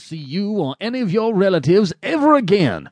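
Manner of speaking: high-pitched indignant outburst; angry farewell. Acted. Angry man banishing someone and his relatives, indignant and high. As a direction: cut them off for good, pitched high.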